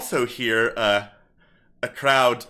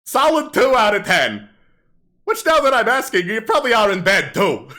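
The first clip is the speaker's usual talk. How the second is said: higher, livelier stage patter; kobold comedian; 10 semitones higher, 6 dB louder than usual. Acted. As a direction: work the crowd like a hammy stand-up comic. Kobold heckles the audience.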